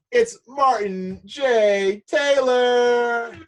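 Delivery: high, loud, stretched ring-announcer call; slow, booming hype announcement of the guest's name; announcer introduction. Acted. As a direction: bring the guest out like a boxing champ. Host announces guest with fanfare.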